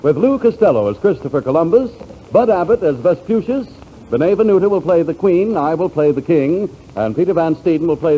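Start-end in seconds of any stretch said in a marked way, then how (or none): none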